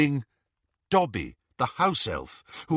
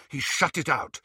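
ordinary delivery

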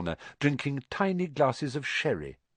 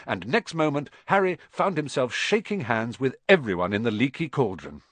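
ordinary delivery, nothing marked

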